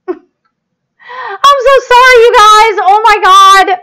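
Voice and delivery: loud, high, drawn-out wail; distraught apology. Real, not acted. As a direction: wail it in exasperated despair. Apologizing as her livestream breaks down.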